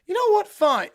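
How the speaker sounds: loud, sulky give-up; huffy villain. Acted. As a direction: concede in a mock huff. Villain sulks in defeat.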